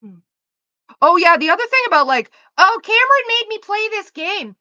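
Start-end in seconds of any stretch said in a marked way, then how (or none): 2.6-4.5 much higher and louder, a whiny mock of her sister; mocking whiny quote. Mimicking her sister blaming Cameron, in a high mocking whine. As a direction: parrot the sister's excuse like a nagging kid.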